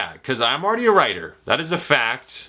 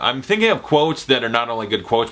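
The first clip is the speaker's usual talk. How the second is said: ordinary talk, nothing marked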